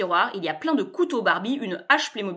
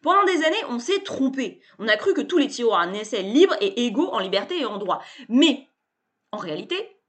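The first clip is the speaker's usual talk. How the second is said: higher and louder, pushy glad-handing pitchman; loud, high, cheerful bluster; brash salesman; 3 semitones higher, 7 dB louder than usual. A narrator's reading. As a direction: barge in like an overfamiliar hustler. Sébastien's pitch.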